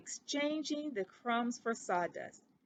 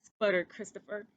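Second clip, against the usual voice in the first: plain reading voice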